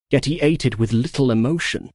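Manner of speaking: plain reading voice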